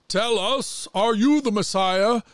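high council questioning Jesus; council demanding. Acted. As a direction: press him for an answer.

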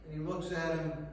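ordinary talk

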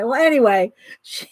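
ordinary talk

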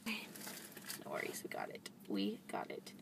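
whispered self-reassurance; soft whisper, calming down. Real, not acted. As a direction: breathe it to yourself. Reassuring self after drop.

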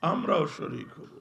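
thundering high sermon shout; preaching at full force. Acted. Preacher to the gathered crowd, thundering sermon shout. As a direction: roar it out to the whole crowd.

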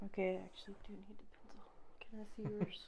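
real hushed aside; whispering. Real, not acted. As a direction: whisper quickly, off the game. Aside asking for a pencil.

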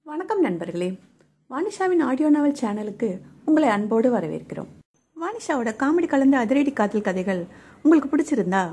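upbeat channel outro; channel announcement. Acted. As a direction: invite listeners to like and subscribe.

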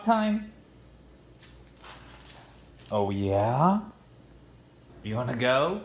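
high, sing-song pet talk; talking to a dog. Real, not acted. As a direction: coo at the dog excitedly. Talking to her dog Thor.

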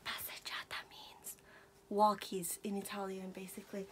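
hushed whisper. Real, not acted. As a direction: whisper it so the dog won't hear. Explaining the word without Gizmo noticing.

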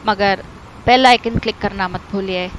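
ordinary delivery

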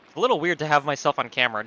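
ordinary talk, nothing marked

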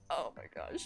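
whispered, stunned; breathless whisper. Real, not acted. Streamer stunned by a donation, whispering. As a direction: gasp it under breath. Overwhelmed by gift.

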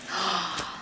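soft gasp of surprise; quiet, awed intake of breath; delighted gasp. Real, not acted. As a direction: gasp softly at a treasure. Sees a longed-for gift.

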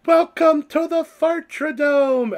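high, loud, drawn-out mock arena welcome; child announcer. Seven-year-old introducing her homemade fart video, high hammed-up announcer. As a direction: imitate a kid's grand video intro, stretching every word. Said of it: "it's her just going".

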